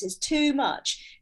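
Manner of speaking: normal talking voice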